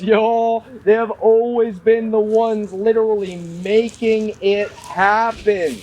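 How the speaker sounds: ordinary talk, nothing marked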